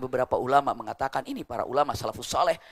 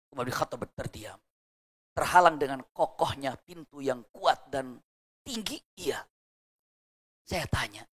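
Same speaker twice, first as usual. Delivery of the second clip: ordinary delivery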